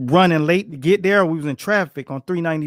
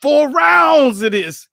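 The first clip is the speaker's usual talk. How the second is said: slow, drawn out and louder, stressing rounds; emphatic; 8 semitones higher, 5 dB louder, slower than usual. Real, not acted. Coach marvelling at four rounds of sparring, slow emphatic stress. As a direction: lean hard on four rounds.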